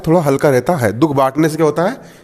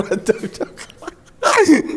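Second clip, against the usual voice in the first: very slow, higher, strained puffing of जब जब; acting out a man heaving through exercise; mock straining exerciser; 6 semitones higher, much slower than usual. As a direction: imitate the man straining through each rep. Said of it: "मेरे को वो दृश्य याद आ गया".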